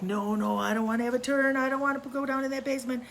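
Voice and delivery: imitating a scared boy who won't go down; scared refusal. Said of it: "And he was like".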